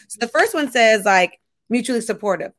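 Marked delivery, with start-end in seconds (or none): none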